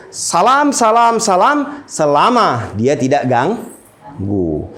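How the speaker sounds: drawn out slow. Real, not acted. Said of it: "Islam begitu".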